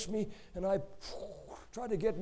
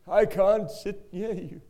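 weeping plea; 4 semitones higher, 5 dB louder than usual. Retold or imitated. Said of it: "He's weeping".

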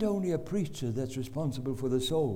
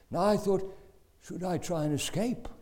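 ordinary talk, nothing marked

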